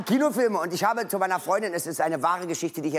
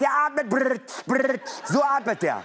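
higher, slow mock heavy breathing with brrrt; girlfriend doing the breathing; 5 semitones higher, slower than usual. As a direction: act out Vader's breath, hammed up. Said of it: "Und sagt".

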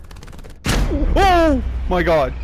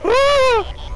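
sudden high scream of fright; startled scream; 3 dB louder than usual. Real, not acted. Streamer jolted by a horror moment, scream. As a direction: yelp at the jolt.

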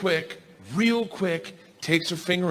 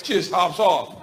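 ordinary delivery

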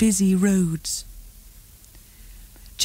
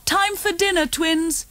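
calling; 8 semitones higher than usual. A narrator's reading. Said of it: "Mummy called".

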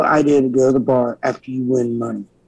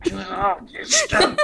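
laughing; 5 semitones higher, 5 dB quieter than usual. Real, not acted. Laughing while describing exercise bike.

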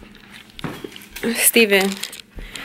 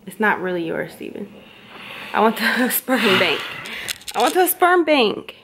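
ordinary delivery

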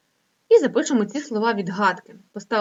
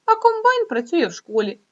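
а rises high and louder, the whole line bouncy; silly mixed-up line; 8 semitones higher, 5 dB louder than usual. Shown as a demonstration. Teacher reading Vitya's muddled line to pupils, playful. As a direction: make "а комбайн працює в школі" sound like a playful joke. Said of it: "Каже".

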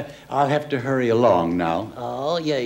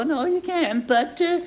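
dummy voice; 10 semitones higher than usual. Acted. Ventriloquist dummy Charlie's voice.

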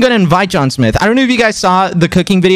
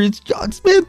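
excited squeal; 13 semitones higher than usual. Retold or imitated. Excited reveal to friend.

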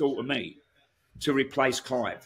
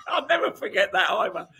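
laughing through the words; 8 semitones higher than usual. Real, not acted. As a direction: chuckle while recalling. Laughing at the memory.

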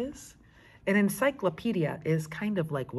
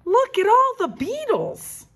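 excited; 8 dB louder than usual. Acted. Frank shows stamp album.

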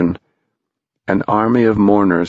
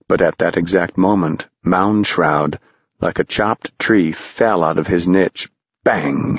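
usual speaking voice

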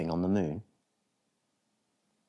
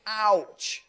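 higher, louder pained yelp; comic ouch; 4 dB louder than usual. Acted. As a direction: yelp it like a bumpy landing. Landing with a bump.

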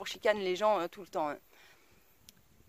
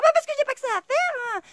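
mock outraged woman, much higher and louder; mocking shrill woman; 6 dB louder than usual. Imitating the angry car owner screeching at me. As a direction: mimic her screeching at me.

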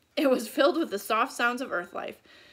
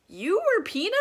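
surprised; 8 semitones higher, 5 dB louder than usual. Acted. Annie learns Morgan was Peanut.

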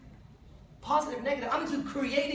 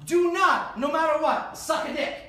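higher, louder stern order; mock command; 5 semitones higher, 5 dB louder than usual. Shown as a demonstration. Pretend warning to a straight man, higher louder stern command. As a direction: bark the forbidden thing. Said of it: "Let's pretend".

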